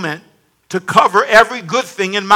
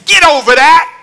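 loud, raised order to yourself; stern self-command; 7 semitones higher, 5 dB louder than usual. Acted. Believer talking to the mirror, forceful self-rebuke. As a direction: order yourself sharply to let it go. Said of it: "get in the mirror and say".

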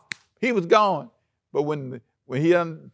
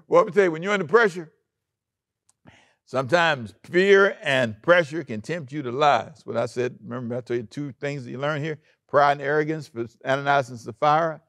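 ordinary delivery

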